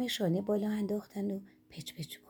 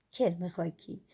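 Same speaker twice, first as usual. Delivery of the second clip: ordinary delivery, nothing marked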